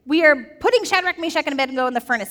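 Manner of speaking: a hammed-up furious king; mad king. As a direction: rage at the furnace keepers. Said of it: "And he is mad".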